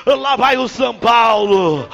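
drawn-out announcer call. Real, not acted. Slow drawn-out announcer calls.

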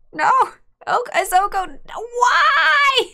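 high, loud wail of despair; real shout of outrage at a card; anguished cry. Real, not acted. Opponent has Oko again.

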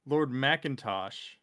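ordinary talk, nothing marked

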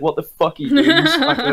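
mock telling-off breaking into laughter; laughing imitation.

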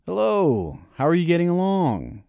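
booming. Acted. Grandpa booming a hello to the worn-out boy. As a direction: boom the greeting out warmly. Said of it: "he boomed".

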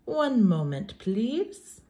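plain reading voice

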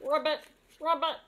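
quick frog croaks; two fast, playful ribbits. Acted. Imitates the frog just revealed.

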